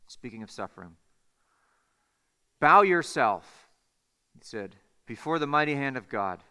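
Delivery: low, hushed, measured delivery with long pauses; solemn sermon closing. Real, not acted. Preacher closing with a Spurgeon quote on suffering, solemn and hushed. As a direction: bring it down softly, phrase by phrase.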